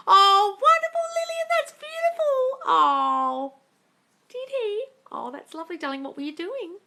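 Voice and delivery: high, loud, sing-song gushing; gushing posh voice. Pretend. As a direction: coo like a posh hostess. Gushing over a viewer's comment.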